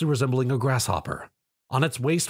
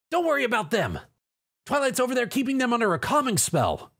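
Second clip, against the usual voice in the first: high, brash tomboy voice, reassuring; Rainbow Dash, confident; 10 semitones higher than usual. Acted. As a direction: bold flier calling out over battle.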